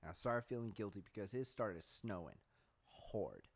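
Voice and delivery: usual speaking voice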